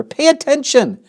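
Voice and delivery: very high, loud scream of the name. Acted. Pig screams to wake the sleeping rat. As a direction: shriek to wake him. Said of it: "screamed Wilbur".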